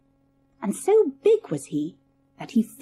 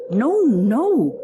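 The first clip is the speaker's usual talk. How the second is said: a mild cry of refusal from the wife; the wife pushes back, a touch louder; 4 dB louder than usual. A narrator's reading. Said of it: "she cried".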